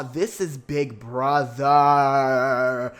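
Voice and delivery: last word stretched out long and loud; hammed-up drawn-out announcer hype; hyped proclamation. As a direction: proclaim it slowly, holding the final word. Hyped retold reaction, drawn out.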